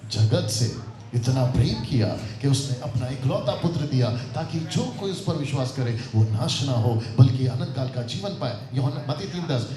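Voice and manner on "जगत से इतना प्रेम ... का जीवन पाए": low, gentle verse recitation; soft reading. Shown as a demonstration. Teacher showing how the love verse is read, soft. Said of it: "पहले soft था".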